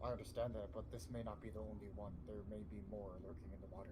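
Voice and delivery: hushed, low voice to the party; pretend whisper. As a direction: softly caution them, voice kept down. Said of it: "Yes, I'm whispering".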